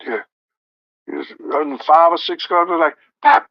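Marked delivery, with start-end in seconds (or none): none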